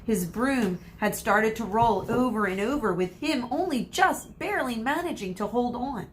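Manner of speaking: ordinary delivery, nothing marked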